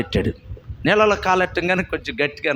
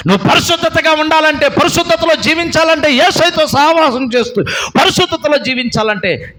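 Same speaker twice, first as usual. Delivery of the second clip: higher, louder preaching at full force; fiery preaching; 11 semitones higher, 6 dB louder than usual. Real, not acted. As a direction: exhort the congregation with fire.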